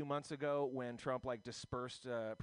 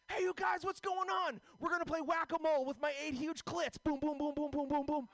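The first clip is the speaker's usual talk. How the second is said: much higher, louder bubbly voice; high-pitched excited host voice; Pam Benjamin; 13 semitones higher, 4 dB louder than usual. Retold or imitated. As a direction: shriek it all high and giddy. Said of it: "Here's my impression of Pam Benjamin".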